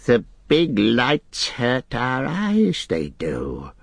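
slow creature whine, high then dropping; Gollum whining. Acted. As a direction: whine it out in complaint. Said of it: "Gollum whined".

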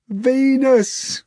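high, loud cowed answer; meek hog reply. A narrator's reading. The hog answers Natasha, high cowed reply. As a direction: give in sheepishly. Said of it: "the hog replied".

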